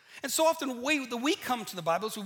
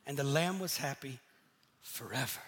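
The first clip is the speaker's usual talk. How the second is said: lower, softer storybook ending; a cute happily-ever-after, gently mocked; sweet storybook voice fading out on the last word; mock fairy-tale ending; 7 semitones lower, 3 dB quieter than usual. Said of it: "stories that ends up like".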